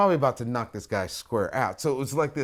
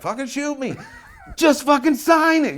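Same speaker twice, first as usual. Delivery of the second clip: defiant yell; 14 semitones higher, 9 dB louder than usual. Retold or imitated. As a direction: dare him outright. Defiant yelling at man.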